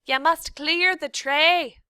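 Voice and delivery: slow, loud, high shouted words. A narrator's reading. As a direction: spell it out loudly to a deaf girl. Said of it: "shouted Cook".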